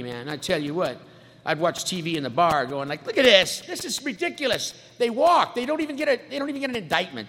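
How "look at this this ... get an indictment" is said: indignant know-it-all. Retold or imitated. Mocking TV pundit rant.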